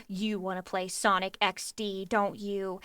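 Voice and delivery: low, soft, slow sinister alter ego; dark alter ego. Retold or imitated. Streamer imitating a YouTuber's dark alter ego, low and slow. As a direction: murmur it like your creepy other self. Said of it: "their dark, like, alter ego".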